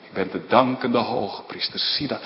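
normal talking voice

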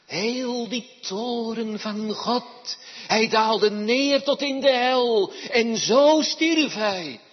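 loud, high, driving sermon voice; fiery preaching. Acted. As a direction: preach it to the whole church. Preaches with full force. (vurig en luid preken)

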